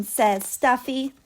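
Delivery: ordinary delivery, nothing marked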